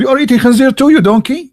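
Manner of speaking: high, teasing jab at the friend; mock retort. Retold quarrel, teller retorts.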